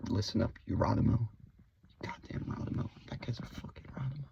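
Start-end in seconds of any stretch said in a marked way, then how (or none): 2.0-4.2 quick unvoiced whisper of the insult; whispered cursing. Shown as a demonstration. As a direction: mutter the curse in a hush.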